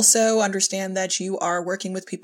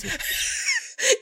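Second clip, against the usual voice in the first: squealing high laugh; 3 dB quieter than usual. Real, not acted. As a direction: burst into laughter. Laughs at the joke.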